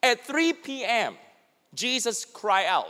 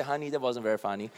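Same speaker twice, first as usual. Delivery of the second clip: soft, fast aside to a spouse; quiet complaint; 10 semitones lower, 11 dB quieter, much faster than usual. Acted. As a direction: mutter it once you're home. Complaining to spouse back home.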